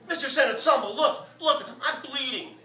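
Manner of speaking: high, loud panicked calling; frantic call. A narrator's reading. Artist calling to the vet about bleeding hand, panicked. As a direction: show him the wound in alarm.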